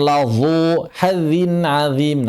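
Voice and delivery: "ladzu" heavy, "hazzin azim" wrongly thin; slow misreading with dark "lazhu" and light "hadzin adzim"; mistaken swapped letters. Shown as a demonstration.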